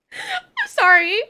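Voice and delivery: teary laugh, can't stop; slow, high laughing apology. Real, not acted. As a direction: gasp it out laughing. Cracking up mid-rant.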